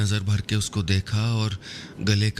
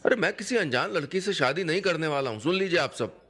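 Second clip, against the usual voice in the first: higher and fast indignant outburst; Sanjay flaring up; 7 semitones higher, faster than usual. A narrator's reading. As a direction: fire it off fast at the whole family. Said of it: "Sanjay तो भड़क ही गया था".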